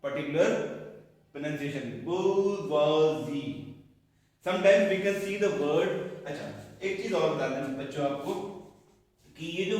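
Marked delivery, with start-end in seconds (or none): 2.1-3.5 bourgeoisie said slowly and louder, held out; modelled bourgeoisie. Shown as a demonstration. Teacher announcing the correct pronunciation, slow and sung. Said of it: "we have to use this particular pronunciation".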